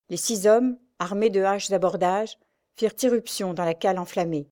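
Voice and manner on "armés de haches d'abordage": ordinary delivery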